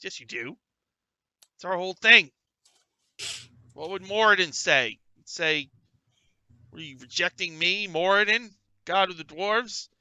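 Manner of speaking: loud, high indignant dwarf yelling; angry dwarf rant. Acted. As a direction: bellow like an outraged dwarf zealot. Dwarf ranting at beardless dwarf.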